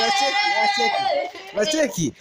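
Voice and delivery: high shouted call; excited shout. Real, not acted. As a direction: yell it out in triumph. Shouting in celebration.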